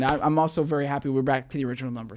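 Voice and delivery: ordinary talk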